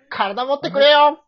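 high, loud, slow cry of strain; straining anime hero. Acted. Hero at his limit pleading to his body, strained yell. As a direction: beg your body to hold out. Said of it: "acted debut line".

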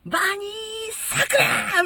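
high, loud ring-name shout; strong wrestler call. Retold or imitated. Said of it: "みたいな感じ". (プロレスの呼び込みのような叫び)

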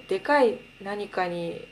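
ordinary delivery

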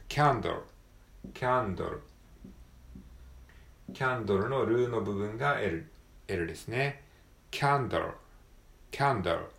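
Candle ending in a clear L, not ル. Shown as a demonstration. Teacher drilling the L in Candle for learners. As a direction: finish Candle on the tongue, no vowel after the L.

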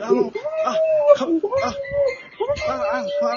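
loud high yelling; shouting over wind. Pretend. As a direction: yell in alarm in the gale. Reporter yells at a bird.

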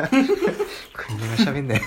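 laughing while speaking; words broken up by real laughter. Real, not acted. Laughing at cohost eating.

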